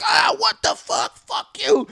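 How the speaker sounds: high-pitched outraged yelling; retold shouting. Imitating angry guys sped past by a car, high shouting. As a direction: mimic a crowd cursing out in alarm. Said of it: "They're all going like".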